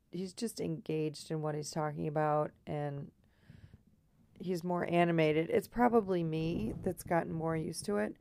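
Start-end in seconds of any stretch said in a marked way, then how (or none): none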